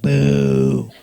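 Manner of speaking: long, low, loud boo; deep, sustained mock jeer; crowd booing. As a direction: imitate a disapproving crowd with a deep "boooo". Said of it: "They were like".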